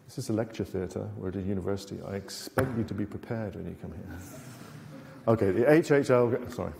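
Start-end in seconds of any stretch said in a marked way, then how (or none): 0.1-4.2 dry, quiet mock scolding; deadpan British sarcasm, softly; sarcastic. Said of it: "My sarcastic".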